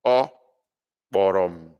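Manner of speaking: "Ọ ... kpọrọ m" broken by a clear pause after Ọ; "Ọ kpọrọ m" spaced out for emphasis; emphatic repeat. Shown as a demonstration. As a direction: say "Ọ", pause, then a firm, deliberate "kpọrọ m". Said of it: "for emphasis sake".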